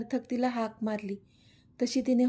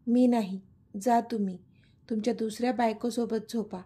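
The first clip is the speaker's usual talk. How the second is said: ordinary delivery, nothing marked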